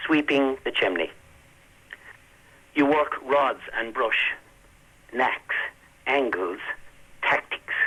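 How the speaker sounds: slow, measured reading of a poem; poetry reading. Acted. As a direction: read it slowly with long pauses. Poet reads his poem aloud.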